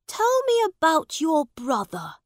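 put-on high young prince voice; the prince asking for the tale; curious boy king. A narrator's reading. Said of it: "said Edward".

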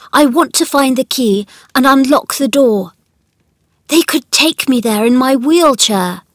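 a hint of a boy growing excited; lightly voiced eager boy. A narrator's reading. Colin longs to see the garden, lightly voiced eagerness.

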